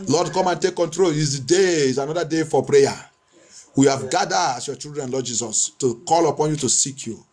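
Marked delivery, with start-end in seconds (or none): none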